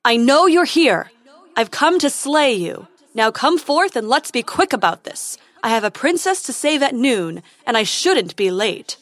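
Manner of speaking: loud, pompous challenge; heroic shouting. Acted. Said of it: "he called into the darkness".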